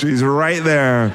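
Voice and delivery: ordinary talk, nothing marked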